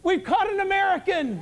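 high-pitched mock accuser; imagined Russian captor. Grandma as the preacher feared her, mock accusing cry. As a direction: crow it high, imitating the grandma. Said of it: "I thought she was saying".

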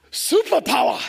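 crowd answering back loud and proud; loud group reply. Retold or imitated. Nigerian pilgrims answering a guide with a loud chant. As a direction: shout it back in unison. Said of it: "they go like this".

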